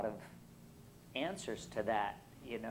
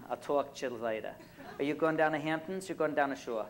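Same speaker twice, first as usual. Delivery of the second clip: broad, casual Brooklyn talk; Brooklyn accent; 3 semitones higher than usual. Shown as a demonstration. Local asking about weekend plans, broad Brooklyn accent. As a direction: toss it off in thick Brooklynese. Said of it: "that's how you speak".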